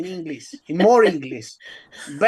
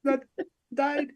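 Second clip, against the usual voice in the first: higher, put-on British voice; mock British accent; 6 semitones higher than usual. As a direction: carry on the fake British accent. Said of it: "do a fucking British ass accent".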